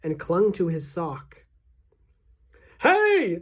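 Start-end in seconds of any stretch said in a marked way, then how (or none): 2.8-3.4 high, loud, drawn-out holler; cook's high yelp of alarm; startled shout. A narrator's reading. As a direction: yell out as if suddenly grabbed. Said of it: "the cook shouted".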